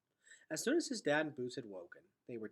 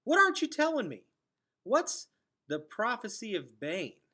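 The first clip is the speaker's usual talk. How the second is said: loud, much higher demand; insistent; 10 semitones higher, 12 dB louder than usual. Acted. Gregor demanding Vicus explain the Prophecy of Bane, loud and high. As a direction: press hard for the truth, voice cracking up. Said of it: "insisted Gregor".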